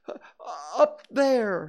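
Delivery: high, loud, gasping reply; Piglet out of breath. A narrator's reading. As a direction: gasp it out in fright. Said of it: "breathing so hard he could hardly speak".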